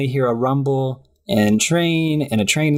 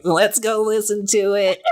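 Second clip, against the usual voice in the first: high put-on character voice; comic high-pitched impersonation; Jason Funderburker; 9 semitones higher than usual. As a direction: imitate an odd kid in a high voice. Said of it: "And Jason Funderburker's like".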